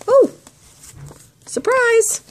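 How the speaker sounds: a sudden high surprise call; surprised exclaim. Real, not acted. Crafter finding a surprise in the swap package, delighted high exclaim. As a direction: sing out the discovery.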